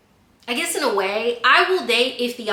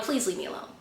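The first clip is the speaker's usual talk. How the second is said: soft, restrained plea; strained calm; 8 dB quieter, faster than usual. Retold or imitated. Hurt walker to the following rider, strained calm. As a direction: keep it quiet and controlled while annoyed. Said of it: "I'm trying to be calm".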